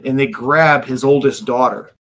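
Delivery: usual speaking voice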